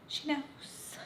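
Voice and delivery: hushed, high, breath-only aside; conspiratorial hush; knowing whisper. Real, not acted. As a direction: whisper it like a shared secret. Dramatic repeated aside to viewers.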